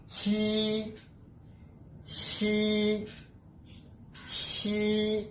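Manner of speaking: छी and शी each held flat and even; slow drill of chhi and shi. Shown as a demonstration. Teacher repeating the sounds for students to follow. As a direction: spell out छी then शी, level and clear. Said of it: "पढ़ना इस तरह है छी".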